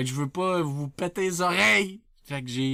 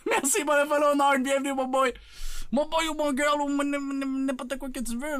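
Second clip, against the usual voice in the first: high, rattled-off excited greeting; hyped welcome; 7 semitones higher than usual. Acted. Streamer welcoming a new follower, high excited patter. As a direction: greet them high, then tumble over the words.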